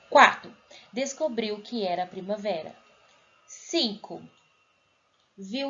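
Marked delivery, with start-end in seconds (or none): 0.1-0.5 Quatro said again, much louder and firmer; louder retake. Shown as a demonstration. Teacher redoing the number after an audio glitch, louder. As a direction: restate Quatro loud and clear. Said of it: "peraí que deu uma falha".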